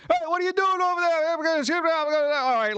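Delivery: high-pitched mock New York hollering; rowdy subway argument. Imitating New Yorkers fighting on the subway, rowdy argument. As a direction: holler accusingly, tumbling into garbled yelling.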